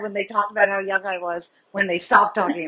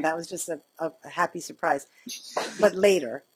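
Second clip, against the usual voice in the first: ordinary talk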